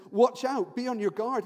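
acted urgent shout of caution; urgent warning. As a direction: warn them of danger coming now. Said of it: "this is really urgent".

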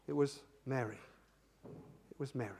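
very soft, low utterance of the name; gentle call. Shown as a demonstration. Jesus calls Mary at the tomb, softly. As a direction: say it tenderly, almost fading away. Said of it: "it wasn't like that. This was like this".

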